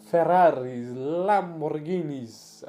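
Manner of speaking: mock Italian accent; hammed-up Italian accent, drawn out. Shown as a demonstration. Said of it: "I don't really have an Italian accent, but I'm trying".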